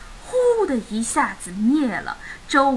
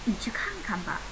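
soft whisper; hushed urging; 2 semitones lower, 12 dB quieter, faster than usual. Acted. Mum sends dad to check the door, whispered. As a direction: whisper it as a gentle nudge.

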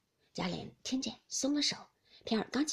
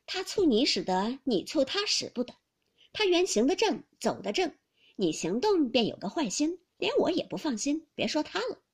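louder, brisk-tempered rebuttal; reproving maid Ping'er; 5 semitones higher, 5 dB louder than usual. Acted. Maid defending her mistress to the husband, reproving. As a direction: talk back in the mistress's defence.

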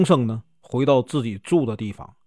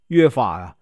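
plain reading voice

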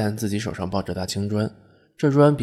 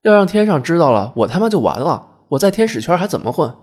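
high, loud, panicked reprimand; worked-up, raised-voice telling-off; agitated scolding; 8 semitones higher, 7 dB louder than usual. Acted. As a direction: berate him for risking your job. Angel berating him for shouting.

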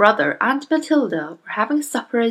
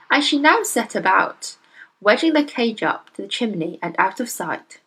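plain reading voice

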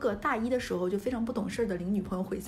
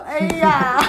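loud, high burst of laughter on the word; laughing hard; 7 semitones higher, 11 dB louder than usual. Retold or imitated. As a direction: let the laugh swallow the word. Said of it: "我小姨狂笑".

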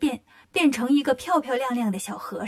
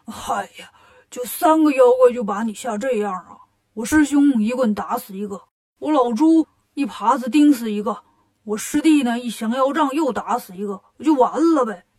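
louder, scoffing pig boast; boasting Bajie; 5 dB louder than usual. Acted. Bajie scoffs at the old man's fear, loud and boastful. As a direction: brush off the danger with a loud brag.

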